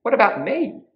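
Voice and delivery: high, loud cat's question; left-out little cat. A narrator's reading. Cat asks for a door too, high protest. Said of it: "which meant".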